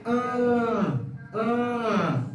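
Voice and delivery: A said through the nose; nasal Portuguese A. Shown as a demonstration. Teacher modelling the nasal A for learners. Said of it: "el sonido nasal".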